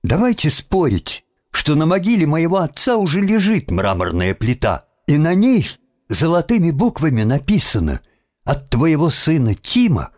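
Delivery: put-on high-pitched, eager boy's challenge; desperate wager. A narrator's reading. Boy betting the watchman about his father's headstone, desperate. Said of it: "пришла в голову отчаянная мысль".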